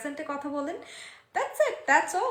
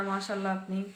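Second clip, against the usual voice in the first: ordinary talk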